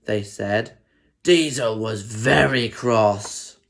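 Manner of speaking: plain reading voice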